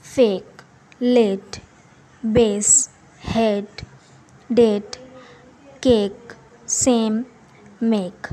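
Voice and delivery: teacher reading example words; word list demo. Shown as a demonstration. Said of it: "बिल्कुल simple ए का sound".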